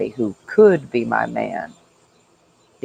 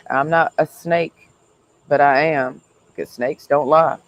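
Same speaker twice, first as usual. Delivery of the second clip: usual speaking voice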